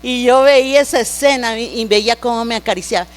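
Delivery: ordinary talk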